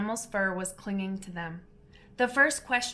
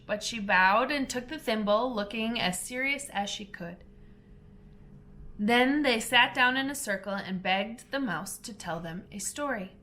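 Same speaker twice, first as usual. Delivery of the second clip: plain reading voice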